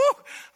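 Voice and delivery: loud laugh breaking through; burst of laughter. Real, not acted. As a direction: laugh out loud mid-bit. Laughing mid-impression.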